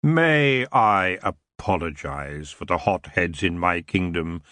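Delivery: a hint of smooth, lazy royalty; the elf king apologizing idly to Tiffany. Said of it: "he continued lazily, his voice smooth".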